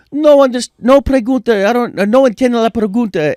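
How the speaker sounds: high, loud mock Portuguese-Spanish accent; imitating Anderson's broken English; Brazilian accent. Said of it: "And he goes".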